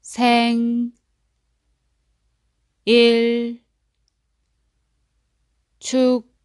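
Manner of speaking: 생일 and 축하해요 as separate chunks, long gaps; syllable by syllable. Shown as a demonstration. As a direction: split 생일 축하해요 into syllables for repeating. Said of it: "One more time".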